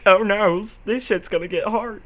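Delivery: usual speaking voice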